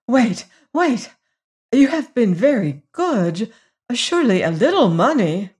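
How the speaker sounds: high, coaxing plea; pleading. A narrator's reading. Lady offering money to departing Hagar, high pleading voice.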